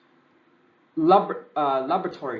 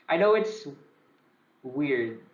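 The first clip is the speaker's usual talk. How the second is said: ordinary talk, nothing marked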